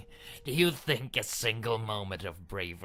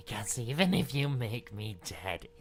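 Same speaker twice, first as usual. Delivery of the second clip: ordinary talk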